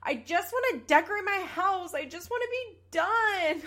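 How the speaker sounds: loud, high, fed-up mock outburst; exasperated imitation. Retelling a woman's reply about her house, exasperated mock outburst. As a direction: act out a fed-up whine, pushed up high and loud. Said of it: "does not like that he said that".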